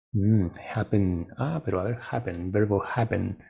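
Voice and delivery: ordinary delivery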